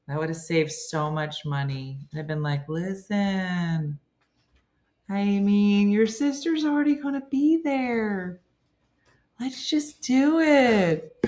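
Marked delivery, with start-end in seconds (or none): 2.7-11.2 drawn-out, rising coaxing pitch; mock persuading. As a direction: coax them slowly, voice climbing high. Imagined persuasion for a double wedding.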